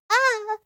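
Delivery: a sudden high startled "ah"; startled gasp. Retold or imitated. Startled at a spooky movie moment, high yelp. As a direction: jump at the scare with a sharp high yelp. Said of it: "made me go".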